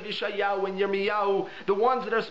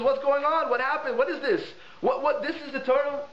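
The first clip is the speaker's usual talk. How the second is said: shocked disbelief; 7 semitones higher than usual. Retold or imitated. Alien staring at the rabbi, shocked and bewildered. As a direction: reel back in baffled disbelief.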